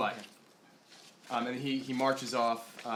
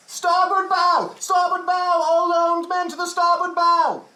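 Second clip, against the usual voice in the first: deckhand yell; 12 dB louder than usual. Acted. Deckhand raises the alarm to all armed men, full shout. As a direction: bellow the alarm across the deck. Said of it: "you hear a deckhand yell".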